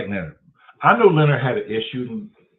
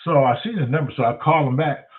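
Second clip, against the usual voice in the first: normal talking voice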